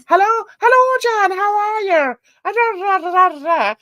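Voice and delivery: high, loud Irish phone caller; very strong Irish accent. Retold or imitated. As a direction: imitate an incomprehensible Irish father-in-law phoning. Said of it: "spoke with a very strong Irish accent".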